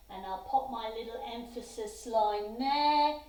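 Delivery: ordinary talk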